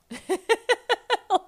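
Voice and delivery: loud, high burst of giggling; high giggle. Real, not acted. Laughing at how they flail in surgery, high giggle. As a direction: break into a real, high-pitched laugh.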